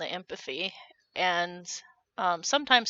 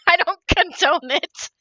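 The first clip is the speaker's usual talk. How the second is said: mock disapproval; 9 semitones higher, 8 dB louder than usual.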